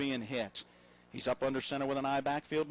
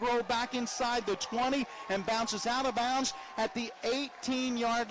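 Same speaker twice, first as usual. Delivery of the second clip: excited call; 7 semitones higher than usual. Real, not acted. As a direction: ride the shank with rising disbelief. Shanked punt called live.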